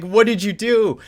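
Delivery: high, loud mock outrage; alarmed accusation. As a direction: imitate someone confronting an attacker.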